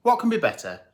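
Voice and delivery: ordinary delivery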